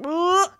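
high, loud held-breath grunt; comic breath-holding cloud; held-breath noise. Acted. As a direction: blurt a strained noise as if holding your breath. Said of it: "the cloud's holding its breath going".

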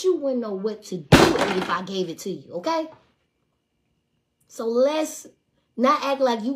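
loud, heated reenacted rant; cussing him out. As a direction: tear into him at full volume. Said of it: "I done cussed his ass out".

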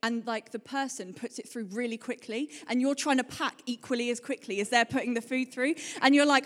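ordinary talk, nothing marked

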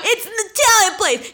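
high-pitched outraged yell, half laughing; loud mock rant; screaming dad. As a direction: shout it like a scandalized dad. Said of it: "He's screaming".

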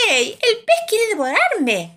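high, loud outcry from a tiny creature; mosquito's shout. A narrator's reading. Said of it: "grita el mosquito". (grito agudo de mosquito)

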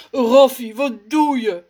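loud startled complaint; annoyed sputter. Acted. Rommes, splashed with milk, sputters loudly at Raf. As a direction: splutter at him, irritated. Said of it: "sputtert hij geërgerd".

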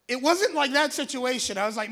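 normal talking voice

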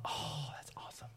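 breathy whispered oh of delight; whispered awe. Retold or imitated. Asking kids if they've oohed, whispered awe. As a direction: hush it like a secret wonder. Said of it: "Have you oohed and ahhed over anything".

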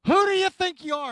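loud and high, offended challenge; indignant outburst. Retold or imitated. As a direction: fire it back at God, stung and indignant. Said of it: "just letting you in on how".

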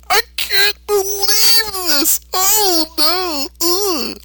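very high, slow cartoon groan; despairing Goku. Goku looking at Mister Satan, high and dismayed. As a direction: moan it out in disbelief. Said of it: "and says".

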